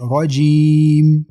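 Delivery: 'رجیم' with the ee held very long; long madd. Shown as a demonstration. Teacher demonstrates allowed elongation, slowly held. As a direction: stretch 'رجیم' up to six counts. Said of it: "شش حرکت کشن جایز است".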